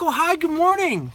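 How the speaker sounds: high, cheery good morning; high chirpy hello; super friendly. Retold or imitated. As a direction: greet him sweetly and brightly. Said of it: "I was clearly, like, super friendly".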